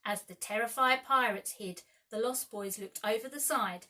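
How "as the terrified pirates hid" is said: ordinary delivery, nothing marked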